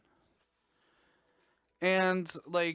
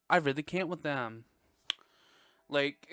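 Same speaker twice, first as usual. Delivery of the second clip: ordinary delivery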